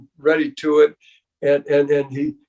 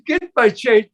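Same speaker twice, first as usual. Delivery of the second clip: mock demand; 7 semitones higher, 3 dB louder than usual. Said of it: "I says".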